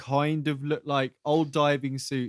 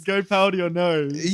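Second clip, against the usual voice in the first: mock chauvinist line; 4 semitones higher, 3 dB louder than usual. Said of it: "that's when he goes".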